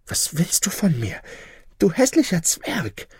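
high, recoiling in disgust and fright; horrified mother. A narrator's reading. Mother recoils from the ugly dwarf, horrified. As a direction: push the stranger away with your voice. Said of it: "mit einem Schrei des Entsetzens".

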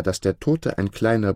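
usual speaking voice

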